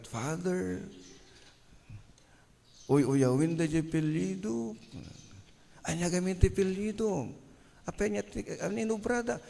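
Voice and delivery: mock-tearful girl's voice, high and soft; crying young girl. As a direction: imitate a little girl's high, plaintive complaint. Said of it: "the young girl was crying". (kunam la agsangsangit a balasang)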